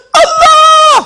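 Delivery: very high imitation scream; hammed-up ecstatic wail; mock cry out. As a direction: cry out as if overcome with longing. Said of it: "Karena teriak".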